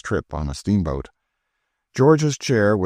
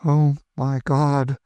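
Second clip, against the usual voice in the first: shocked gasp; 8 semitones higher, much slower than usual. A narrator's reading. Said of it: "fell insensible on the floor".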